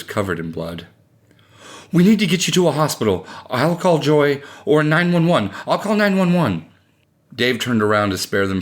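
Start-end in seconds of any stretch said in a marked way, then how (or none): 1.9-6.6 high, urgent alarm; worried, raised-pitch insistence; panicked wife. A narrator's reading. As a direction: take frantic charge in an emergency. Wife panics over bloodied husband.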